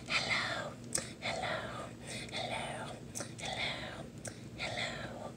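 imitating a soft ASMR whisper; mock ASMR whisper greeting. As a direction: whisper each hello to the camera like an ASMR host. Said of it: "you're whispering".

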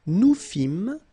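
"Nous fîmes" ending on m, no S; clear, high, loud "Nous fîmes" as nou fim; silent final S. Shown as a demonstration. Teacher modelling a verb form, silent final S. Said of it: "you don't pronounce the S".

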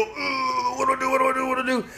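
very high, strained mock panic; Michael all tensed up. Learner driver begging his teacher for help, high mock panic. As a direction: imitate a driver frozen at the wheel, pitch way up. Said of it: "He's all tensed up on the wheel".

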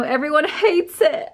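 high, laughing protest. Real, not acted. Telling viewers about her disliked little light, laughing. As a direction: break into a laugh while protesting.

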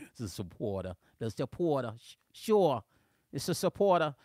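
teasing, put-on New Jersey accent. Shown as a demonstration. Host cracking up a viewer with his New Jersey accent. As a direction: play up New Jersey on "supporter" and "sure". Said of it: "here's my New Jersey accent".

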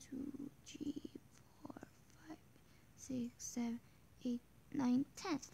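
counting in a whisper. Real, not acted. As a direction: whisper the numbers. Counting players under breath.

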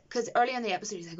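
ordinary talk, nothing marked

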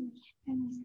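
put-on whispered child's hint; little girl whispering to her dad. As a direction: breathe it as a secret.

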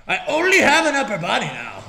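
amused. Real, not acted. Character cut in half.

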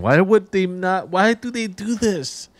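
drawn-out, high-pitched exasperation; high, incredulous complaint; exasperated. Real, not acted. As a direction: whine in protest at the game, stretching each word. Ranting at game design.

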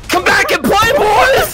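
very high manic shriek; screaming. Acted. Seeker calls fleeing boys back, screaming. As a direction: shriek after the runaways.

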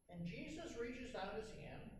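usual speaking voice

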